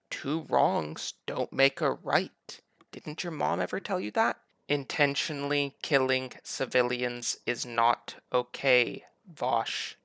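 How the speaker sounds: slow, deliberate, condescending; slow lecture. Real, not acted. Host lecturing Vaush like a child, slow and patronising.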